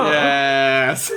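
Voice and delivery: loud laughing cheer of victory; excited shout. Real, not acted. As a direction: shout yes in triumph. Celebrating a win.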